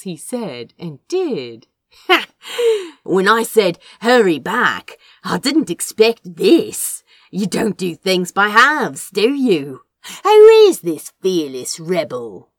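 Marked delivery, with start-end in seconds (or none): 2.1-3.0 amused laugh. A narrator's reading. Crow amused at Azra's boldness. 3.0-12.5 loud, amused teasing; grinning tease. A narrator's reading. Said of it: "He grinned".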